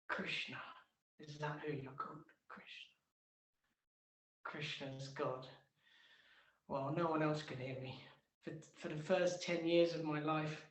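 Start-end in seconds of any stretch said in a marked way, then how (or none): none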